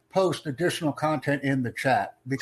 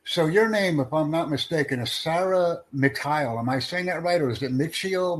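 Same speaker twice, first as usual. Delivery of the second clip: normal talking voice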